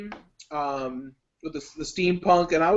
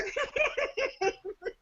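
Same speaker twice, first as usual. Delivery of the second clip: high villainous cackle; put-on evil laugh, pitched very high; dastardly laugh; 7 dB quieter than usual. Shown as a demonstration. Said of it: "they have to have a dastardly laugh".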